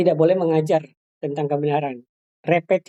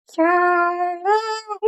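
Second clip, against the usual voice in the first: high, slow little "Shalom"; the puppet tries the word; small puppet voice; 13 semitones higher than usual. Shown as a demonstration. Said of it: "suara boneka". ("Shalom" dengan suara boneka kecil)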